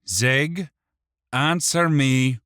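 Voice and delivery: loud, high, slow demand. A narrator's reading. Wick presses the imp for an answer, demanding. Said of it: "Wick demanded".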